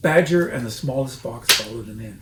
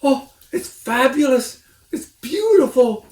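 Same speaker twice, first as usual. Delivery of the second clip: high, loud gasping praise; breathless rapture; 12 semitones higher, 9 dB louder than usual. A narrator's reading. Mister Fox gasps over the cider. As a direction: gush with no breath left. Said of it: "fighting for breath".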